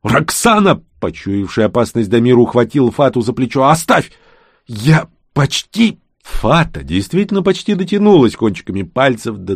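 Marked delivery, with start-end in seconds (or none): 0.0-0.8 high warning cry of the name; sharp raised shout of warning; alarmed call. A narrator's reading. Damir cries out, sensing danger. 3.7-4.1 high, raised command; urgent cry; urgent warning. A narrator's reading. Damir urges her to stop. 4.7-6.0 high, slow, effortful words; strained and broken off; straining effort. A narrator's reading. Witch straining towards the crystal.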